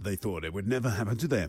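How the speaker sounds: mock spooky narrator. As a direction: intone it deep, like a horror voiceover. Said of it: "do the voice from the Thriller video".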